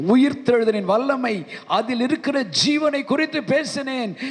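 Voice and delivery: ordinary delivery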